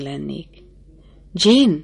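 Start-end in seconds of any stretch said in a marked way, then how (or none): 1.3-1.8 a loud call up from below, falling at the end; shouting a name. A narrator's reading. A voice downstairs calling the bride Jane, loud call. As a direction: call her name down the stairs. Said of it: "kiáltott valaki odalent".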